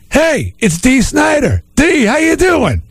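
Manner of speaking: high, slow hammed-up call out; mock shout from a car. Driver spots the singer by the road, mock shouting. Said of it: "a guy looks out the window and goes".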